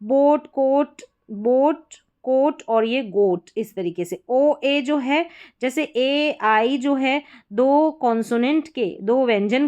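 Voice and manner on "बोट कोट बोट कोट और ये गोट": bout, coat, goat said slowly and carefully; slow model words. Shown as a demonstration. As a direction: sound out each word for the class. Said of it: "और यहाँ पर देखिए ओए है".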